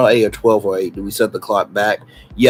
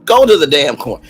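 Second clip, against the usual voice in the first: louder, faster mock order; mock angry command; 4 semitones higher, 8 dB louder, faster than usual. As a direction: snap it harder the second time. Repeats the order, angrier.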